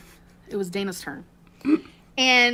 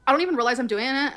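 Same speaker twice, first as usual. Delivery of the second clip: ordinary delivery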